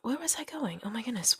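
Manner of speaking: whisper, flustered at losing the thread. Real, not acted. Loses her train of thought.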